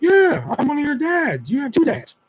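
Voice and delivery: very high, lilting parent voice; talking to a child. Retold or imitated. Dad explaining two dads to daughter, child-directed voice. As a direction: pitch it way up for a little kid.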